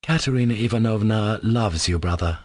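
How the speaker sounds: ordinary delivery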